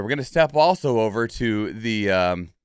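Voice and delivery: usual speaking voice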